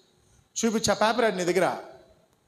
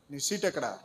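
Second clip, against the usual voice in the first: ordinary delivery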